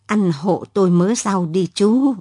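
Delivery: plain reading voice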